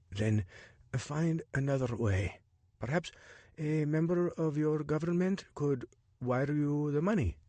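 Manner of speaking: lightly voiced Jabari, measured and cautious; a hint of an informant taking a risk.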